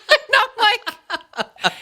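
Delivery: high, loud burst of laughter; laughing at her own story; laughing hard. Real, not acted. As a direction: crack up while telling it. Laughing at the sangria mixup.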